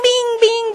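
chirpy high-pitched bing, bing; ping like a little flashing light; high bing beacon sound. Retold or imitated. Preacher imitating a beacon, high chirpy bings.

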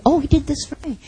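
ordinary delivery

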